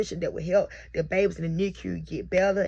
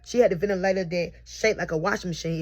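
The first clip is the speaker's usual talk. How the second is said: ordinary delivery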